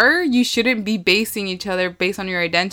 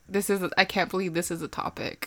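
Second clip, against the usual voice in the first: soft imitated incredulous aside; quiet mock disbelief; 10 dB quieter than usual. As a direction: murmur it as someone's unspoken thought. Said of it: "in your face, you're like".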